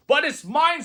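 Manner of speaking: high, loud screech; screamed. A narrator's reading. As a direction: shriek it possessively. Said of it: "screamed the bird".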